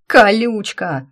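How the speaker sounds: sudden loud yell; shout. Acted. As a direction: yell the nickname slowly. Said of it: "заорал Максим".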